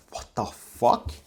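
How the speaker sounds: taken aback after being shoved, let it hang; in-character shock. Retold or imitated. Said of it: "In character, obviously".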